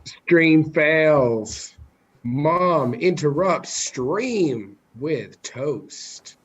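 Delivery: slow, drawn-out headline voice; mock headline announcing. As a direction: announce it like a dramatic title. Joking headline about mom interrupting.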